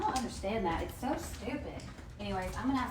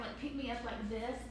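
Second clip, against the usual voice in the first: normal talking voice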